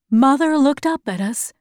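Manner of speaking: plain reading voice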